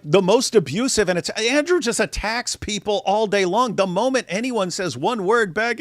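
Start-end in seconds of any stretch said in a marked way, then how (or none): none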